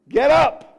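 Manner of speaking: loud, high command; commanding call. Retold or imitated. Preacher voicing Jesus calling dead girl to rise, loud command. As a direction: call her up from death.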